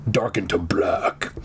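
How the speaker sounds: dropped into a dark whisper; ominous whisper. A narrator's reading. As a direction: whisper it for spooky effect. Ominous repeat while narrating.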